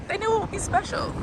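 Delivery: small, high, soft voice; touched. Real, not acted. New Washingtonian touched by parents' gift, high and soft.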